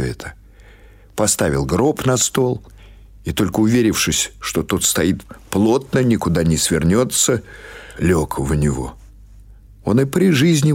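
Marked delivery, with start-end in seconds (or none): none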